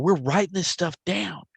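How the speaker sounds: ordinary talk, nothing marked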